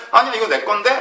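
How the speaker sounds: a selfish person snapping back, mock indignant; greedy protest. Preacher voicing someone refusing to share, mock indignant. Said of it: "라고 하는 것도 욕심이에요".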